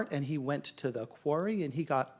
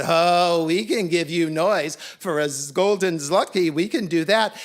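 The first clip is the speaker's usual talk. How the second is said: loud, cocky cats taking the job; big confident voice; eager boasting; 9 dB louder than usual. A narrator's reading. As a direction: brag that it's easily done. Cocky cats accept noise challenge.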